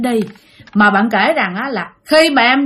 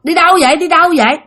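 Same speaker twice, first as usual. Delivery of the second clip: lightly voiced mother, higher-pitched scolding call; 6 semitones higher than usual. Ghostly mother's voice calls the child from the café, calling out. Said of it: "Nghe kêu vậy nè".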